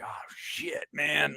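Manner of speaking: voiceless, hushed swearing to oneself; whispered reaction. Retold or imitated. Moved by the mom and dad scenes, whispered. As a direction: whisper it under the breath, overwhelmed. Retells his reaction in theater.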